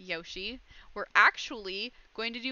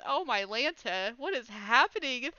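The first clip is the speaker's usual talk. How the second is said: ordinary talk, nothing marked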